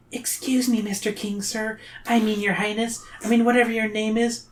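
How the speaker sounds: put-on high, soft, timid girl's voice; Zoe. A narrator's reading. Zoe interrupts the king, high polite little voice. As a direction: speak up high and small, fumbling politely. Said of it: "Zoe interrupts him".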